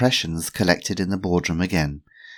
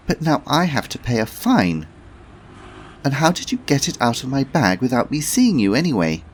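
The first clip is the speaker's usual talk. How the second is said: put-on higher, louder young woman complaining; Felicity; 8 semitones higher, 5 dB louder than usual. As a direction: grumble at the old lady. Felicity voiced.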